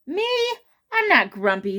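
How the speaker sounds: squeaky high Me, then loud denial; indignant surprise. Acted. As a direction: act shocked, then protest. Jim denies again.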